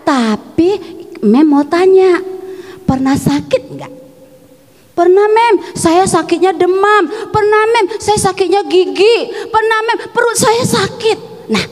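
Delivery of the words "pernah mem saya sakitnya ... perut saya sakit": put-on high-pitched child answering the teacher; imitated schoolchildren replying in a raised pitch; pupils answering. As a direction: pipe up like an eager pupil, higher voice. Children answering teacher about being sick.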